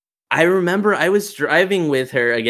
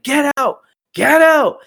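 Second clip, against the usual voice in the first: high-pitched shouted order; imitated yelling; 9 semitones higher, 4 dB louder, slower than usual. Retelling the babysitter yelling while looking at the child, imitated high yell. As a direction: mimic her commanding yell. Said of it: "Beatriz just started yelling".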